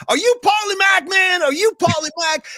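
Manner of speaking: imitating Dwight's excited South Carolina accent shout. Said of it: "that South Carolina accent".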